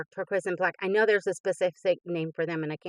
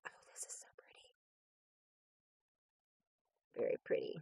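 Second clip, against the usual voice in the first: breathy hushed whisper of admiration; soft awed whisper; 17 dB quieter, much faster than usual. Real, not acted. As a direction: whisper it as if in awe. Admiring stickers softly.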